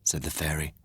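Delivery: plain reading voice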